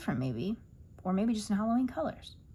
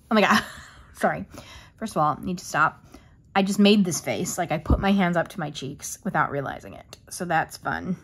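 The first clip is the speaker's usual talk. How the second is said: ordinary delivery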